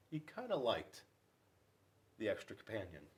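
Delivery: plain reading voice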